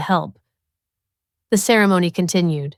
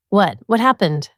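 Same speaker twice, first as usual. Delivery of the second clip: ordinary delivery, nothing marked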